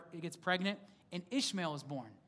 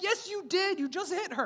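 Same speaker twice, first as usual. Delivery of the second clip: insistent dad; 11 semitones higher, 3 dB louder than usual. Retold or imitated.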